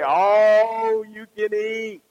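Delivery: "all" stretched long and loud; relished ALL. Acted. Diner at the buffet reading the offer, long loud "all". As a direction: draw out "all" with great relish.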